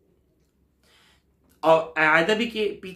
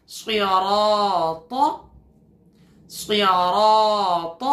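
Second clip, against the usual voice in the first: drawn-out tajweed recitation; slow chanted recitation; slow Quran recitation; much slower than usual. Shown as a demonstration. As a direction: stretch the word for students to echo. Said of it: "آپ میرے ساتھ ساتھ پڑھیں گے".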